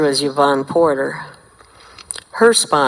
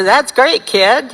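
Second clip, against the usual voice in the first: breezy mentor; 9 semitones higher, 4 dB louder, slower than usual. Retold or imitated. Mentor voiced, brushing it off.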